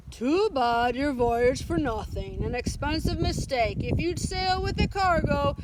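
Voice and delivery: smug mock-sympathetic sea captain; gloating sympathy. A narrator's reading. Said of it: "gloated over them and made polite sounds of sympathy".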